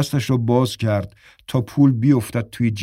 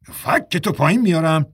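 a sharp, slightly higher threat; snapping threat; 6 semitones higher than usual. Acted. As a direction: bark the threat at him. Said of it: "بیشاپ تشر زد". (تشر با لحنی تهدیدآمیز)

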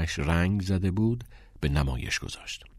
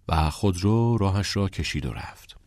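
usual speaking voice

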